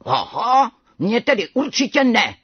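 put-on young tiger's roar. A narrator's reading. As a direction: roar back a refusal. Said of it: "zařval malý tygr".

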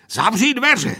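high, loud order; boyish command. A narrator's reading. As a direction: give the order sharply and loudly. Pilot orders before take-off. (hlasitý rozkaz)

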